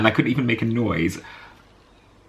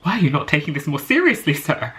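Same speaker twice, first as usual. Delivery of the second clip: inner indignation; 6 semitones higher than usual. Retold or imitated. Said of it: "I'm inside, I'm just like".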